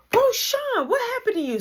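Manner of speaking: high startled shout of alarm; alarmed mom. Retold or imitated. Mom seeing her son's bleeding ear, alarmed. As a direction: gasp out her worry.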